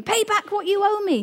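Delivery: the servant demanding his money back, forceful. Acted. Preacher voicing the greedy servant's demand, forceful. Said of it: "he demanded".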